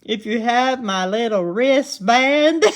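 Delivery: hammed-up Southern accent, high and loud; exaggerated Southern accent. Shown as a demonstration. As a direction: play up your Southern twang for laughs. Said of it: "my Southern accent strong there".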